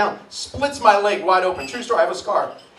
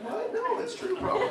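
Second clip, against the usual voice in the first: put-on much higher, soft girlish reaction; girls reacting; 13 semitones higher, 7 dB quieter than usual. Girls in the audience freaking out, higher and softer. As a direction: react like a startled teen girl. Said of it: "They're like".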